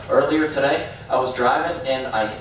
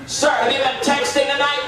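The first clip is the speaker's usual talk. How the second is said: lightly voiced policeman, pitched higher; a hint of an officer's interrogation; stern officer; 11 semitones higher than usual. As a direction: question a driver like a suspicious cop. Police officer questions him at checkpoint.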